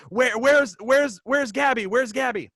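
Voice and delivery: high, loud repeated calling, re-enacted; panicked yelling. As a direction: yell out for your wife in mock panic. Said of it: "I'm yelling for, for my wife".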